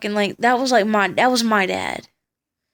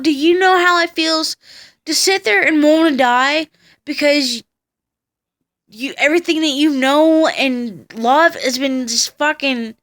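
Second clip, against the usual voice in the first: retold angry outburst; 5 semitones higher than usual. Twelve-year-old snapping at the social workers in the car, raised angry voice. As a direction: let the hurt boil over as you retell it. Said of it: "I just, I just snapped".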